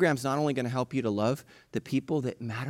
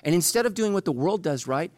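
ordinary talk

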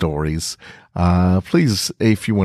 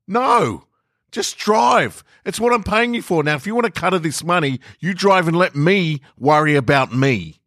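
much higher, fast, impatient voice; angry passenger; 11 semitones higher than usual. Acted. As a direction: snap at the driver to just go. Passenger snapping at the driver.

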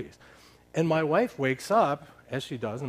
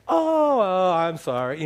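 high, loud "oh" easing into apology; apologetic realisation; 5 semitones higher, 6 dB louder, slower than usual. Retold or imitated. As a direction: act out a slow, sheepish climb-down. Acted realisation and apology after misunderstanding.

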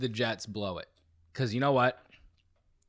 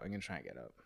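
strained, much softer and lower; straining while pushing up from the chair; getting up from chair; 9 semitones lower, 15 dB quieter, much faster than usual. Real, not acted.

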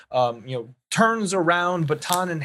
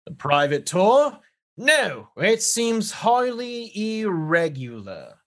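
high, slow, doubtful guard voice; British guard; 6 semitones higher than usual. Acted. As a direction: query it like a suspicious British official. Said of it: "this guard turns to you and says".

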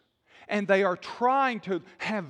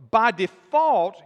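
"By default" slow and much louder, each word hit; stress on default; 3 semitones higher, 10 dB louder, slower than usual. Acted. Preacher to congregation, hammering the point: loud, slow "By default". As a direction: punch "default" hard and slow.

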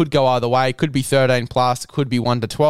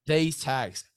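sponsor ad read; 9 dB quieter than usual. Acted. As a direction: hype the product like a radio ad.

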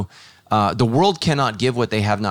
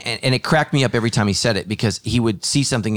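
ordinary talk

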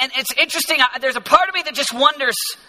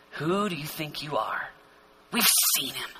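quiet scoffing; 6 semitones lower, 10 dB quieter than usual. Acted. Scoffers voiced challenging Jesus, hushed.

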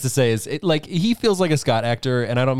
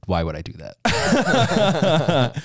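Connery imitation; 5 semitones higher, much slower than usual. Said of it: "And he said".

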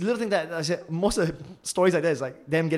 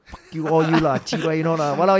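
loud, brash Singlish rant; mock Singaporean Singlish outburst, louder; 10 dB louder, faster than usual. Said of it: "speaking in Singlish the whole of this podcast".

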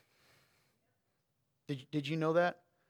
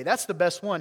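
ordinary talk

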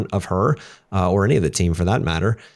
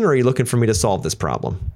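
ordinary talk

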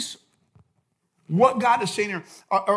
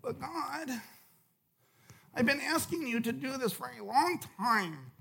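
mock whiny complaint, softer and slow; 4 semitones higher, 9 dB quieter than usual. Disciple complaining to God, mock whiny. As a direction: whine at God like a sulky child, played lightly. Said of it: "we get real whiny".

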